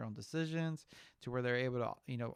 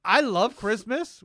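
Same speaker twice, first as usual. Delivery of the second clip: high, loud, drawn-out gush; gushing enthusiasm; 10 semitones higher, 15 dB louder, slower than usual. Real, not acted. Co-host declaring his love of Christmas, gushing. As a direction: stretch it out with big, laughing delight.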